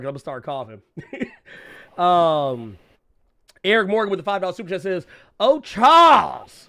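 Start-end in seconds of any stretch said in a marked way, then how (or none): 5.4-6.7 slow, louder, drawn-out "Oh, Charles"; drawn-out mock sigh. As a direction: stretch it like a weary sigh. Reading a chat line.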